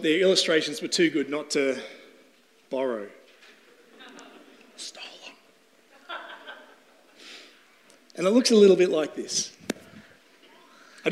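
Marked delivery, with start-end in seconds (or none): 4.8-6.2 whispered aside; breathy joking whisper. Real, not acted. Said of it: "aside joke".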